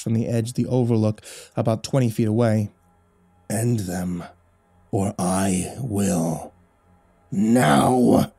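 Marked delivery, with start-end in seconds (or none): 3.5-8.3 menacing demon voice. A narrator's reading. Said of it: "the demon screamed inside my skull".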